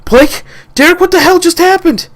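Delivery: high, loud call across the field; alarmed shout. Acted. Logan calling to Blake and Derek: high alarmed shout. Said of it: "I could hear Logan shout".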